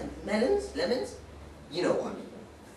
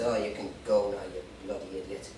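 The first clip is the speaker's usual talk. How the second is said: low dismissive warden; 12 semitones lower, 5 dB quieter than usual. Retold or imitated.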